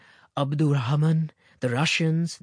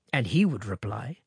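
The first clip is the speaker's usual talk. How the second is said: ordinary delivery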